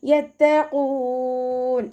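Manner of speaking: യത്തഖൂൻ with heavy ഖ, drawn slowly; heavy qaf. Shown as a demonstration. Teacher models the heavy qaf, slow chant. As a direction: recite യത്തഖൂൻ with a full, heavy ഖ. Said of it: "ഖാഫ് ഇസ്തിഅലാൻ്റെ ലെറ്ററാണ്".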